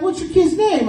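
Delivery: high, prissy front-desk lady voice; prim school receptionist. Retold or imitated. School lady questioning the stepdad, high prim voice.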